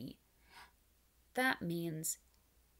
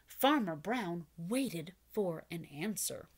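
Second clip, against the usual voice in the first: plain reading voice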